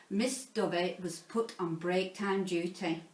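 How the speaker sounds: ordinary delivery, nothing marked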